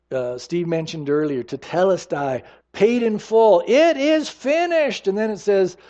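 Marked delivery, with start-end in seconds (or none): 3.7-5.1 slow, high, weighty proclamation; emphatic last words. Acted. Preacher voicing Jesus on the cross, slow and weighty. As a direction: let each word land like a final verdict.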